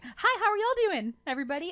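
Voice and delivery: hammed-up friendly Southerner; overeager Southern hello. Greeting a room of Brits, hammed-up Southern. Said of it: "I'm like".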